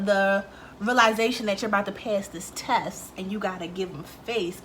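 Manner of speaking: usual speaking voice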